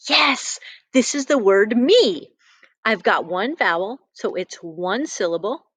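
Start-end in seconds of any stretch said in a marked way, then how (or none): none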